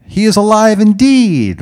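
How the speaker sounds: high, loud crowd callback; full-voiced, sustained congregational shout. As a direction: call back with rising energy. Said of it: "with a little more energy".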